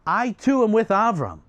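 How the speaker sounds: high, proclaimed vow; bold declaration. Acted. Haran siding with his brother before the king, bold declaration. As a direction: announce allegiance for all to hear.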